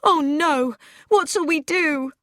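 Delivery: frightened outburst after gunshots; alarmed woman after hearing shots; crying out in alarm. A narrator's reading. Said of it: "Mildred cried out".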